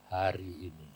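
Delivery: very low, hushed answer; quiet spirit reply. Retold or imitated. Doctor's spirit answers his startled friend, hushed and low. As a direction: let it fall softly and calmly.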